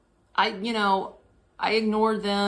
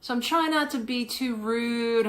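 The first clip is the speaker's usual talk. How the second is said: ordinary talk, nothing marked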